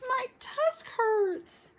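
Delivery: high, very slow whimper; whiny complaint. Acted. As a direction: drag it out, hurting. Hurt child complaining.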